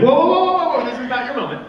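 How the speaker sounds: high, loud mock "whoa" to cut him off, fading; stopping Tommy. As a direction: playfully hold someone back. Franco cutting Tommy off on stage.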